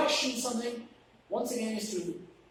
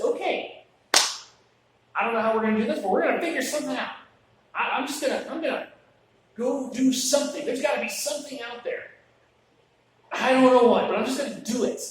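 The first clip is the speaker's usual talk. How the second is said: eager can-do disciple; 4 semitones higher, 7 dB louder, faster than usual. Retold or imitated. Andrew's eager quote, retold.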